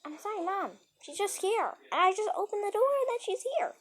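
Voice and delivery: high, loud, hurried pleading to mom; frantic child apologizing to mom; pleading apology. Retold or imitated.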